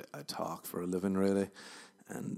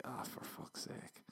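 slow, exasperated whisper; 14 dB quieter, slower than usual. Retold or imitated. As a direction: breathe it out, fed up. Exasperated reaction to heckler.

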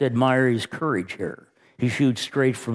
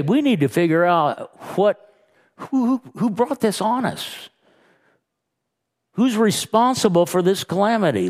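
panicked sailors; 8 semitones higher than usual. Acted. Panicked sailors cry out in storm.

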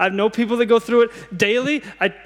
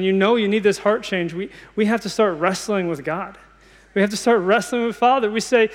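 ordinary delivery

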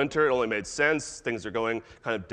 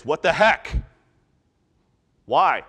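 sudden louder burst of disbelief; mild indignant protest; 2 semitones higher, 6 dB louder, slower than usual. Acted. Disciples when something hits, indignant protest. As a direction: demand to know why, affronted.

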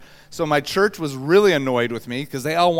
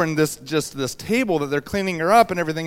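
normal talking voice

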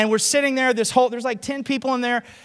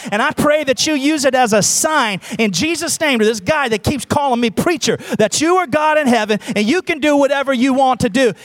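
loud, driving revival-style prayer; re-enacted bold preacher's prayer; forceful prayer; 3 semitones higher, 7 dB louder than usual. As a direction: pray at full force without letting up. Re-enacts forceful prayer over truck.

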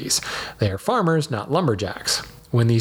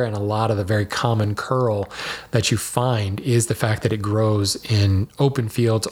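normal talking voice

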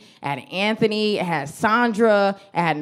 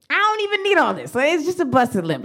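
mock protest; 6 semitones higher than usual. Protesting the hospital trip.

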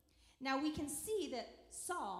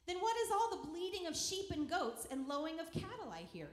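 raised, louder demanding question; 5 semitones higher, 5 dB louder than usual. A narrator's reading. Samuel confronting Saul, demanding. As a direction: demand an answer, pitched up. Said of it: "Samuel demanded".

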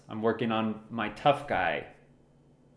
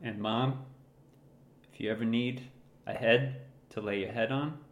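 ordinary delivery

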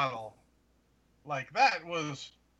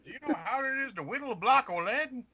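put-on higher, quavering old-timer; indignant old geezer; old man voice; 10 semitones higher, 4 dB quieter than usual. Old man bit continues.

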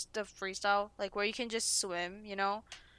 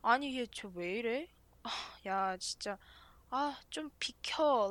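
put-on grumbling old women; 4 dB quieter, much faster than usual. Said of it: "and they're like".

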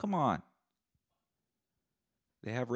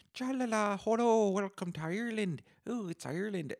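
high, loud singsong welcome; singsong Irish welcome, much higher and louder; mock Irish tourism ad; 12 semitones higher, 4 dB louder than usual. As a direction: ham up a cheesy Ireland tourism greeting.

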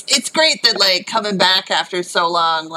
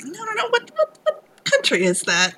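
mock bafflement; 14 semitones higher than usual. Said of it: "said that about the accent".

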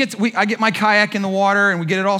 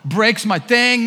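usual speaking voice

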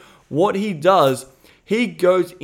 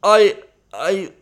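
mock choked-up stammer; 3 semitones higher, 5 dB louder than usual. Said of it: "you get choked and you're like".

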